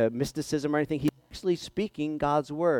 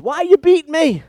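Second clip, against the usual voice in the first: high, loud indignant donkey voice; a donkey's loud high protest; talking donkey; 12 semitones higher, 9 dB louder than usual. Acted. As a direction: squawk it like an offended donkey. Talking donkey confronts Balaam.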